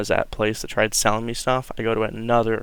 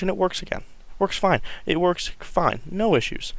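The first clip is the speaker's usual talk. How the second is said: excited relief; 7 semitones higher than usual. Real, not acted. Happy the rifle works again.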